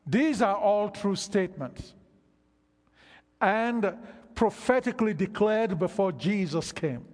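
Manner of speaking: loud, driving sermon delivery, pressing each line; fervent preaching. Acted. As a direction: preach it hard to the congregation. Preaching at full force.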